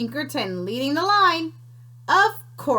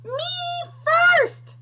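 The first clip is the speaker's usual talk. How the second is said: very high shrieked "Me first!"; 3 dB louder than usual. Acted. Piglet shrieking while galloping toward the voice. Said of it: "Pinkerton shrieked".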